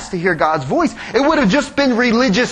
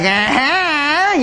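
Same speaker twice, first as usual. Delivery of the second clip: sneaky bride; 4 semitones higher than usual. Retold or imitated. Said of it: "don't she just look sneaky".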